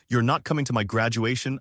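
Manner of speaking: usual speaking voice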